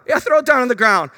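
high, loud breezy order; loud playful command; bold command. Acted. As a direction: fling the instruction out cheerfully. Preacher voicing God's command.

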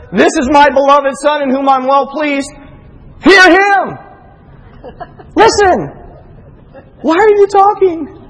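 high, loud, exasperated proclamation; acted loud command. Voice from the cloud interrupting Peter, loudly exasperated.

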